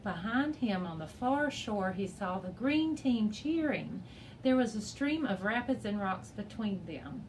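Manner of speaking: usual speaking voice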